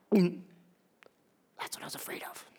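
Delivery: slow, very soft whisper; dread-filled whisper. Acted. Saul realizing it is Jesus, whispered dread.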